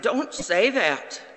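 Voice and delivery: put-on a child pushing the words back at his dad; upset child. Son rejecting his father's words, upset and loud. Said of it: "And then Benjamin replied".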